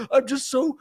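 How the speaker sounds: high, slow, choked-up mock sob; mock bawling. Reenacting his tearful interview on the roadside, mock sobbing.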